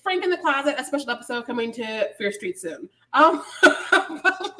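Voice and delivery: usual speaking voice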